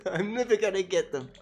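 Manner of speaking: ordinary delivery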